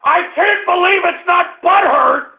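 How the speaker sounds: high-pitched, indignant outburst; outraged cry. Real, not acted. Host fed up with being ridiculed, outraged cry. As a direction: wail it in fury.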